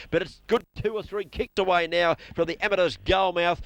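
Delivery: usual speaking voice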